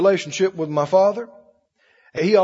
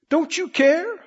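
pleading disciples; 8 semitones higher than usual. Retold or imitated. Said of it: "They're saying to him".

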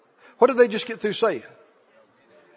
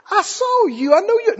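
much higher, pointed; insistent accuser; 10 semitones higher than usual. Acted. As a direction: nail him down. Bystander insists.

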